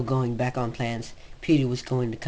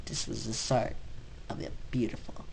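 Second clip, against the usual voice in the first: dramatic hush; 8 dB quieter than usual. Acted. Dramatic ending of the fic.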